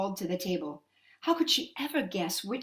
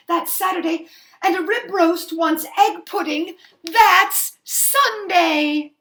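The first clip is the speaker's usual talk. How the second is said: rising louder and higher to a slow finish; triumphant; 5 semitones higher, 8 dB louder than usual. Acted. Mother naming each child for the witch, building in triumph. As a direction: build to victory.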